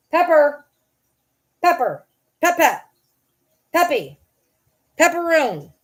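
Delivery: high, loud pet-name calls; real summons to a pet; calling the dog. Real, not acted. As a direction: call out for your dog, short and high. Calling her dog to come.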